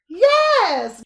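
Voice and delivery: high, loud cheer; excited yes. Real, not acted. Cheering a friend's confession, a high loud yes. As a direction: cheer them on.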